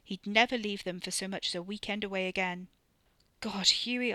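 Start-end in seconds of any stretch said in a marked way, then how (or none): 3.4-4.1 anguished whisper. Acted. Anguished silent plea to partner.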